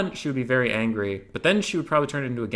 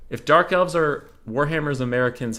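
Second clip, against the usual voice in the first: ordinary talk, nothing marked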